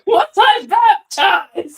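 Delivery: loud, high, drawn-out call for father; mock shout. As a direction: holler it through the house, hammed up. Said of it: "Just shout".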